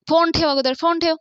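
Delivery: acting out an impatient demand; insistent order. आई दत्ताला phone ठेवायला सांगते. (चढ्या आवाजात हुकूम)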